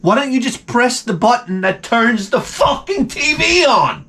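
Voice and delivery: high-pitched, worked-up exasperation; exasperated rant. Acted. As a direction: build into a fed-up dad's rant. Exasperated dad's rant about remote.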